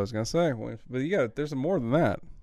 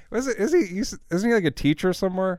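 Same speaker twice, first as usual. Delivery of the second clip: ordinary talk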